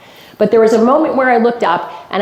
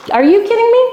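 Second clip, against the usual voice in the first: disbelief; 11 semitones higher than usual. Retold or imitated. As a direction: cry out as if you can't believe it. Disbelief at cheering crowd.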